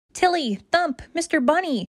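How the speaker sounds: high, excited call of the friends' names; rescued puppy calling to friends above; happy shout. Acted. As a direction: call up to them with relief. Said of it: "Diamond shouts".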